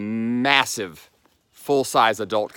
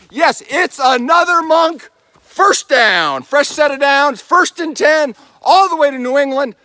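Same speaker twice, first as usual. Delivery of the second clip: loud, high play-by-play caller; sports announcer; 13 semitones higher, 9 dB louder than usual. Pretend. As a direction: call it like a touchdown. Football announcer calls after crossing.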